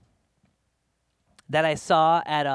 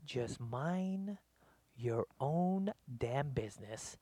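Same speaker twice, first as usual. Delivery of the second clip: a soft, slow mutter under the breath; muttered retort; 4 semitones lower, 12 dB quieter, slower than usual. Retold or imitated. Onlooker silently telling the lady off, quiet slow mutter. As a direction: say it to yourself, quietly annoyed.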